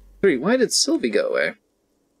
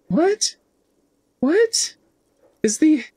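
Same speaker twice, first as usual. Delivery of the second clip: stunned disbelief; 3 dB louder, much slower than usual. Real, not acted. Baffled by the talking necktie.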